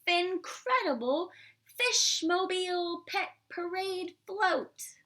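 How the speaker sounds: grand reveal. A narrator's reading. Reader to children, unveiling the fishmobile with excitement. Big reveal of Maxine's invention.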